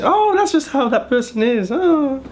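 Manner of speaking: very high breezy dismissal; dismissive Chinese relative. Retold or imitated. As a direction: wave it off lightly up high. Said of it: "They just say".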